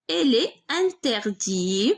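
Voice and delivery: very slow, last syllable of interdit stretched; drawn-out, measured syllables; slow dictation. Shown as a demonstration. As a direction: dictate it slowly for someone writing along. Dictates the answer while writing.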